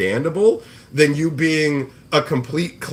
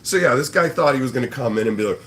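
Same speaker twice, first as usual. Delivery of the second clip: normal talking voice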